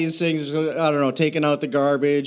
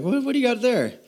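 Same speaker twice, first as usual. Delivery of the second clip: mock curious mom; 9 semitones higher than usual. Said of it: "I'm like".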